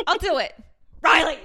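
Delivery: loud high call of the name; loud high call to Riley. Real, not acted. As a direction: shout the name across the room. Calling out to Riley.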